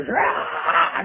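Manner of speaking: mock battle cry, rough and drawn out; battle yell. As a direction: imitate a wild battle yell. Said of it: "gives a huge battle yell".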